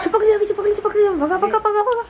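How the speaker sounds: high, teasing shoo; playful shooing. Real, not acted. To the clinging parrot, a high playful shoo. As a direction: chase the bird off playfully.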